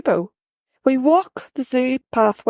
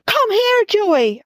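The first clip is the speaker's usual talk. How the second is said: cross shout; 10 semitones higher, slower than usual. A narrator's reading. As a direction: scold him as you call. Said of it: "I shout crossly".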